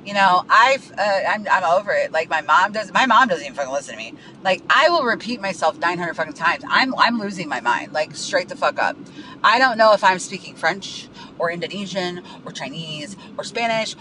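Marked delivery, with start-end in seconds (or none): none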